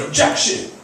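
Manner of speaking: mock courtroom protest; defense attorney objecting. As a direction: jump in as an indignant TV lawyer. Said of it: "he jumped up and he said".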